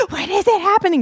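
high, pleading mock desperation; crazy impatience. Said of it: "being crazy on the podcast and being like".

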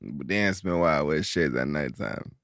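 slow, soft rapper impression; low, drawn-out celebrity impression. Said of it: "that's actually a really good impression".

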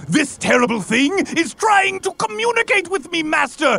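very high, wheedling horse voice; whiny talking horse. Acted. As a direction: plead with master like a spoiled child. Horse pleading to the judge.

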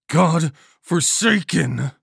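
slow, loud, high roar; furious roar. A narrator's reading. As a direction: drag the curse out at a shout. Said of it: "still roaring in fury".

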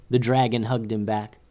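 ordinary delivery, nothing marked

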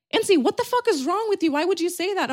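high, startled disbelief; a friend's alarmed high-pitched reproach; shocked outburst. Retold or imitated. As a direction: react like she just said something insane. Friend freaking out at her.

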